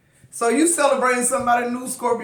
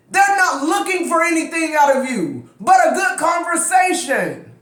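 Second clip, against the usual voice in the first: fired-up yelling; 5 semitones higher than usual. Real, not acted. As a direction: shout it so they can finally hear. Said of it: "Why I'm yelling?".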